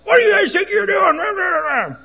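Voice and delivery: high, drawn-out angry yell; loud, strained, high-pitched holler. Retold or imitated. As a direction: imitate an irate stranger yelling from below. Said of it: "he starts yelling at us".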